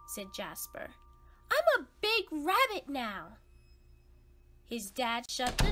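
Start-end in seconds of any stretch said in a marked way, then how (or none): 1.5-3.4 put-on insistent kid.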